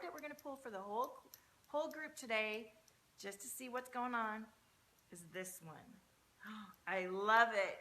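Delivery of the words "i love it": slow, loud delighted I love it; delighted gasp. Real, not acted. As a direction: gasp and savour the card. Gasps at the drawn card.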